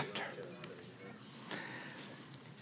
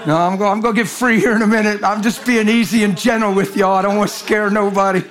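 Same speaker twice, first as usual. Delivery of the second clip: ordinary delivery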